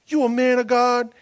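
high, loud call to his little son; playful father hype. Retold or imitated. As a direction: pump up the toddler like a coach. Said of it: "I would look at him and go".

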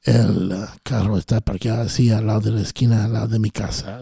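hammed-up bedroom voice in Spanish; mock sexy Spanish. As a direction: croon mundane Spanish as if it were seduction. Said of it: "tell me something sexy, sexy in Spanish".

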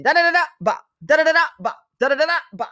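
mimicked punch combo. Fight commentator imitating Holly Holm's combinations with high punch noises. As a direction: rattle off a punch flurry as sound effects. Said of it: "That's how she throws".

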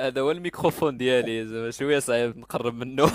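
loud, close to the mic. Real, not acted. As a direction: talk right into the mic. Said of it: "الصوت ما كيتسمعش مزيان".

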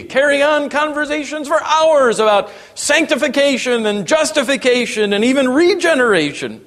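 high, driving pulpit delivery; impassioned preaching. Real, not acted. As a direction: press the questions on the congregation with rising fervor. Preaches at full force.

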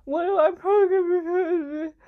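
high, loud incredulous squeak; mock disbelief. Retelling her reply to the teacher, loud, high disbelief. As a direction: gasp it out at the teacher's warning.

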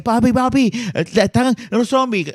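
put-on high, loud, urgent child calling his dad; panicked kid calling. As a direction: call out to papi like a frightened child. Son voicing scared kid calling father. (niño asustado llamando a su papá, agudo y fuerte)

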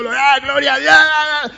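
loud, high exclamations; mock fiery preacher shout; preaching cry. Shown as a demonstration. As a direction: cry out glory to God. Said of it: "le hablara así".

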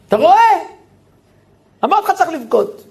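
high, loud, fast I-told-you-so; gloating that crying worked; triumphant mother. Retold or imitated. אמא מנצחת מול אבא. (אמא מתגאה בקול גבוה ורם)

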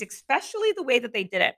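normal talking voice

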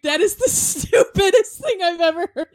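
high and loud, cracking up mid-quote; retelling through laughter; laughing. Retold or imitated. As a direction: quote the little brother while laughing hard. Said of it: "He was like".

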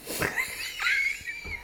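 big laugh. Real, not acted. Loud laugh at joke.

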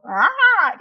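high, loud mock yelp of anger; angry outburst. Person who can't control themselves, mock angry yelp. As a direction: burst out like someone losing control. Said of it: "And sometimes they'll".

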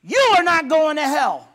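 loud, slow, high commanding shout; bold shout. Acted. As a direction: shout them out of their excuses. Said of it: "Because someone says".

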